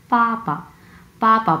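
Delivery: পাপা with p sounds, not b, first syllable stressed; crisp p in পাপা, second syllable let go; fourth tone, then neutral. Shown as a demonstration. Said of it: "actual উচ্চারণ হচ্ছে".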